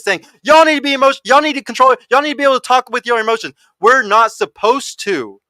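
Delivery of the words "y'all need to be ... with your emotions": higher, louder mimicked nagging; mock yelling. Man imitating women who keep yelling demands, mock yell. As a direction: imitate insistent yelling, pitched up. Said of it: "keep yelling this".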